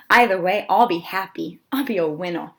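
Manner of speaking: ordinary delivery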